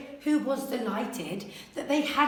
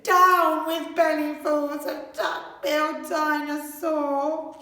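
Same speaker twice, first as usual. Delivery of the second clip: hooting; 3 semitones higher, 4 dB louder than usual. A narrator's reading. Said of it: "And he hooted".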